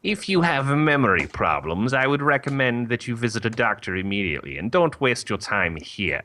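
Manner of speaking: brisk, disapproving mother superior; nun voice. Acted. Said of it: "when I thought nun voice".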